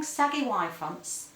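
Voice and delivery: ordinary delivery, nothing marked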